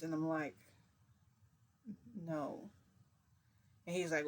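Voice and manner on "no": small, soft no; quiet refusal. Retold or imitated. Answering his request to hear a voice, quiet refusal. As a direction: refuse under your breath. First, reluctant refusal in retelling.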